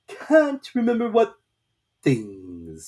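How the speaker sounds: very slow, loud, strange drawn-out voice; slow caterpillar drawl. A narrator's reading. Caterpillar lazily questioning Alice, very slow and loud. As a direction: stretch every word lazily, from on high.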